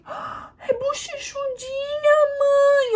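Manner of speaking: high, slow, gushing cry; delighted nurse. Retold or imitated. Nurse telling the mother in delight the baby is chubby, high and slow. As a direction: gush over the chubby baby. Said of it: "uma falou assim".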